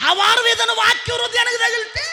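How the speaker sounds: high, loud call; preaching shout. Acted. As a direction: call it out to the crowd.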